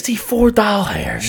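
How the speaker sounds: high, slow incredulous echo; mock outrage. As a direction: squeal the price in exaggerated disbelief. Repeats mock shock at price.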